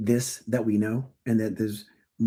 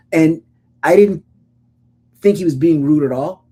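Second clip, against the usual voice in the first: ordinary talk, nothing marked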